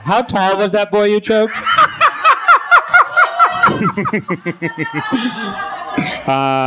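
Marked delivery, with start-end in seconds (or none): 1.6-6.2 long squealing laugh; long high cackle. Real, not acted. Joke about the choked boy.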